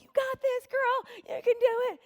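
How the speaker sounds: high, bright mom's pep cheer; mother cheering her daughter toward the fire. Retold or imitated. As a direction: pump her up from the sidelines. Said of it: "and I'm like".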